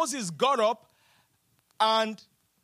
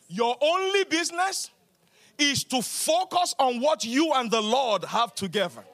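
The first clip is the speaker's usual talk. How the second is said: ordinary talk, nothing marked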